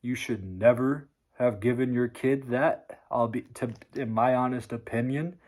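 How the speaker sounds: ordinary talk, nothing marked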